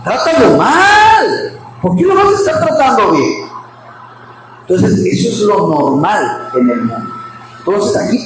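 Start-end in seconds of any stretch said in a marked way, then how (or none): 0.0-3.5 mock worldly friend. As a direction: play the bossy friend urging her to fight back. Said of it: "qué te diría una amiga del mundo".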